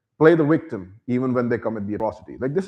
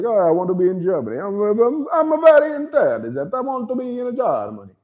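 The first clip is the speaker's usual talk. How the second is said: high, fast, pleading mock Italian; eager Italian; 7 semitones higher than usual. Mocking an Italian who wants into Germany, high and pleading. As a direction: play a giddy immigrant begging to get in. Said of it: "If the Italian is like".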